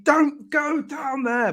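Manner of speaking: mock scream warning. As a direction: cry out a slow warning, pitched high. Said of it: "they're screaming".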